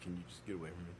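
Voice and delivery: quietly muttered get-away-from-me; muttered brush-off. Retold or imitated. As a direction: mutter it so the boss barely hears. Muttered reply to GM.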